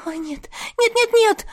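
rising panicked wail of no. Acted. Amulet lost, panic.

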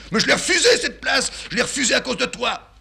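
very high, loud, shrill-pitched outburst; father shouting he refused the job for his son; outraged shout. Acted. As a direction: explode in wounded pride. Father explodes.

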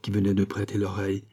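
usual speaking voice

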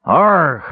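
loud, high cry of alarm; alarmed roar. Acted. Caramon waking to a face over him, alarmed roar. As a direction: roar out in sudden fright. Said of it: "Caramon roared in alarm".

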